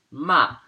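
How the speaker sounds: MA falling sharply; fourth tone. Shown as a demonstration. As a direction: drop the pitch quickly on "ma". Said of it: "the fourth tone is".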